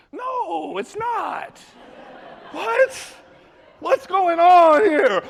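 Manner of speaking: very high, stretched-out wail; hammed-up high-pitched disbelief; shocked protest. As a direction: cry out in bewildered denial.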